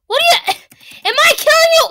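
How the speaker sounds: high, loud startled cry; shocked yelp. Real, not acted. Gamer startled to find he's killing her, loud and high. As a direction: blurt it out in disbelief.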